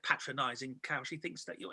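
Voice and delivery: low, quiet retold aside; muttered grumble. Passenger complaining once the attendant has gone, muttered low. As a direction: grumble it low under your breath.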